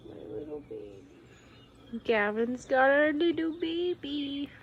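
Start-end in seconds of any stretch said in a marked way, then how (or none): 0.1-2.0 whispering. Real, not acted. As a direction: breathe it softly to the pet. Whispered take of same words.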